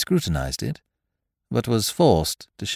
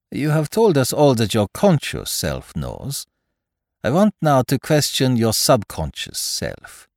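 put-on higher, deliberate detective with foreign accent; Poirot, foreign-accented detective; 6 semitones higher, 4 dB louder than usual. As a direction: propose the test in a French-style accent. Voices Poirot in dialogue.

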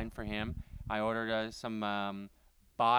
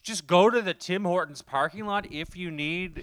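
mocking disbelief; 5 semitones higher, 7 dB louder, faster than usual. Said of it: "mocking mom's advice".

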